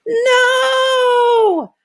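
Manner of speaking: sudden high, loud cry of "No!"; a sharp alarmed shout; yell. Acted. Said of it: "I yell".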